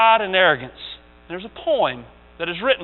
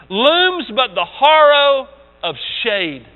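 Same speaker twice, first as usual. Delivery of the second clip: ordinary delivery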